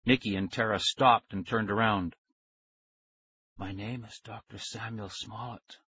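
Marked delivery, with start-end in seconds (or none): 3.6-5.8 very soft, whispery man's voice; thin whisper. A narrator's reading. Stranger reassuring captured kids, thin whisper. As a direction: speak in a thin, hushed voice. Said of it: "He had a thin whisper of a voice".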